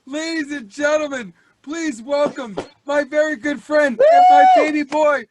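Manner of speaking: loud, booming host build-up; big emcee welcome; announcer introduction. Acted. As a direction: hype the crowd for your guest.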